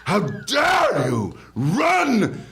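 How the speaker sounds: high, slow mock scolding shout; scolding teacher. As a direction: shriek it like an outraged old teacher. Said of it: "She called me a wicked beast".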